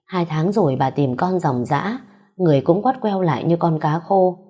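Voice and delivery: usual speaking voice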